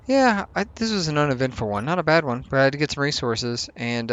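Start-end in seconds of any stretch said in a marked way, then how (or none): none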